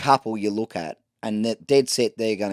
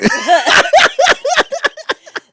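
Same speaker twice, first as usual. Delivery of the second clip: loud high burst of laughter; big laugh; 11 dB louder than usual. Real, not acted. Host cracking up at his own story, loud and high. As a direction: crack up hard.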